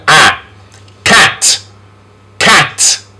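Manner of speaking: short A, crisp separate Cat; easy sound demonstration. Shown as a demonstration. Teacher models easy sound, short A in Cat. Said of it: "Easy sound".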